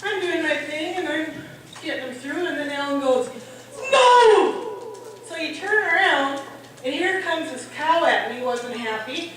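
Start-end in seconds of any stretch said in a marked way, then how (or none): 3.9-5.0 sudden high, loud shout; imitated urgent holler; yelled warning. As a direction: shout to stop someone. Husband yelling out at cattle work.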